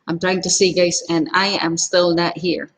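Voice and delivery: usual speaking voice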